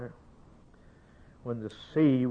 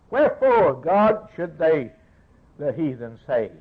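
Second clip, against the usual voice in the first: raised, pleading pulpit cry; fervent preaching; 5 semitones higher, 7 dB louder than usual. Acted. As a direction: thunder it from the pulpit, higher and louder. Preaching at full force.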